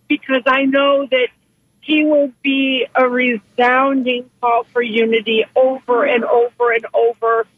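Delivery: usual speaking voice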